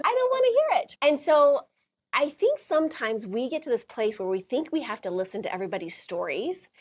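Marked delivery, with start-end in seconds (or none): 0.0-1.0 loud, high-pitched retold refusal. Refusing to hear another birth story, high-pitched and loud.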